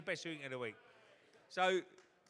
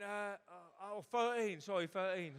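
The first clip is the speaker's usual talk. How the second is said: flustered Tony; 6 semitones higher, 3 dB louder than usual. Retold or imitated. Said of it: "He went".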